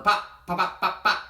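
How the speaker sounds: high, loud bursting pops; excited popping. A narrator's reading. Narrator bursting out the corn popping, high and loud. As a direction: let each pop burst out bright.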